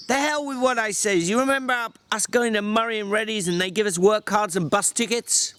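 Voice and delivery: a weary ranch hand berating his companion; George, exasperated. Acted. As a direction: snap at him like a fed-up older brother. George cuts Lenny off.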